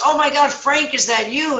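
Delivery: high-pitched surprised greeting; acted excited relief. Trapped man recognises his friend, acted excited cry. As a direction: exclaim upward to a friend.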